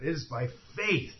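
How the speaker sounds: usual speaking voice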